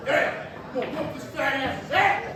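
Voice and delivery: high, strained mock tough guy; high-pitched, muted blustering; mock threat. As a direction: imitate an agitated man making threats. Acting out ejected man's threats.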